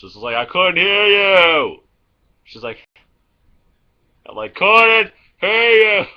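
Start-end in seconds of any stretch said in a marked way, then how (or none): 0.4-1.8 high, loud, slow yell; shouting into mom's ear at the loud fair. Retold or imitated. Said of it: "I was yelling pretty much". 4.5-6.1 yelling. Retold or imitated. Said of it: "It's like yelling in her ear".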